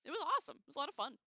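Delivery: thrilled memory of teaching in Japan; excited. Real, not acted. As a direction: gush about how great it was. Enthusing about Japan years.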